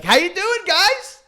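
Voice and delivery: high, loud singsong hello; mock-cheery greeting. Vice principal mock-greeting the caught boys, high singsong. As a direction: greet them with fake cheer.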